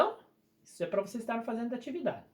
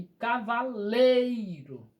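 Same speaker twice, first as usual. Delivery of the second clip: cavaleiro with "lei" stretched out and louder; drawn-out penultimate syllable in "cavaleiro"; stress on LEI; 3 semitones higher, 4 dB louder, much slower than usual. Shown as a demonstration. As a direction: slowly lean on the LEI in "cavaleiro". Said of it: "Então, exemplo".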